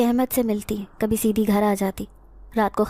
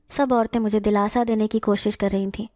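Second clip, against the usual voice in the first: plain reading voice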